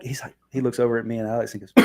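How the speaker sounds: usual speaking voice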